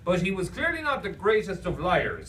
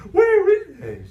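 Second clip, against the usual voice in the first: loud, high-pitched silly wail; eager cartoon cry; 9 dB louder than usual. Imaginary friend asks for the questionnaire, eager high cry. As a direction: ask like an overexcited clown.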